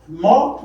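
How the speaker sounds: teacher isolating the stressed syllable; isolated stressed syllable. Shown as a demonstration. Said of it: "that is stressed".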